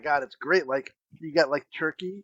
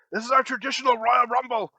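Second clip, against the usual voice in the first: mock-proud dad, pitched up; announcing dad; 5 semitones higher than usual. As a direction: lightly play a dad declaring the big event. Acts dad announcing to his kids.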